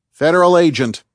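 loud, high shout to announce authority; yelled command. A narrator's reading. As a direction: yell it out like an armed agent challenging a suspect. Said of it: "he yelled".

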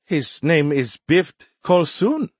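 slow, high voice rising questioningly; name him slowly, a little puzzled; puzzled young alien. A narrator's reading. Alien liaison naming their possible enemy, slightly puzzled. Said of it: "Nert replied with a slightly puzzled expression".

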